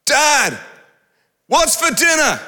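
put-on high child voice calling from upstairs; kids calling. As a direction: play the kids shouting down. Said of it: "our two young sons going".

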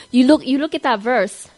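ordinary talk

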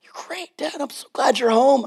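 put-on high, soft little-kid voice; adoring kids. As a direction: gush in a small, high voice like children greeting dad. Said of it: "My kids praised Daddy".

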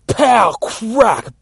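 loud, high comic-book punch sounds; shouted impact noises. A narrator's reading. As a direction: hit each word like a blow.

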